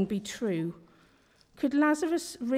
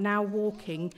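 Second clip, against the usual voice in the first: usual speaking voice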